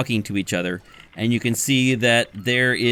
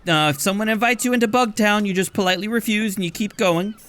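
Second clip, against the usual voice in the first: much higher voice giving directions; chatty barkeep; 8 semitones higher than usual. Acted. Barkeep gives long directions to Cat Salvage, high voice. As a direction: rattle off the route.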